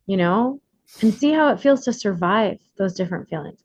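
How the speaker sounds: normal talking voice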